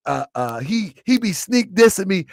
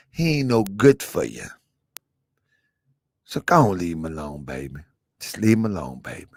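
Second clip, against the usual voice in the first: hushed dad soothing his daughter; 11 semitones lower than usual. Retold or imitated. Father voice continues.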